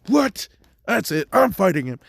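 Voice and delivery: high, loud indignant yell; outraged outburst. Retold or imitated. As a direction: explode and demand the fight. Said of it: "And Endeavor's just like".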